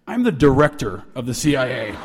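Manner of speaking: low, stern authority; John Deutsch. Retold or imitated. CIA director revealing his rank to student, stern. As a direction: announce the title low and weighty. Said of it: "My name is John Deutsch".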